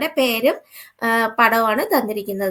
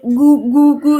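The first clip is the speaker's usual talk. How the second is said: loud, repeated owl hoots; 7 dB louder, much slower than usual. Shown as a demonstration. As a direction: imitate an owl hooting. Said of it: "എന്നും പറഞ്ഞിട്ടൊരു സൗണ്ടാണ് മൂങ്ങയുടേത്". (മൂങ്ങ മൂളുന്നതുപോലെ)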